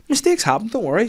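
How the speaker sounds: high gentle reassurance; reassuring dad. Retold or imitated. Dad reassuring his daughter after an accident, gently. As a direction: soothe a small child kindly. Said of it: "I was like".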